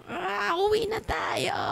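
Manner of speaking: very high, slow whiny voice; fleeing demon. Retold or imitated. The demon giving up on the woman, very high and whiny. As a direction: whine it like a defeated spirit wanting out.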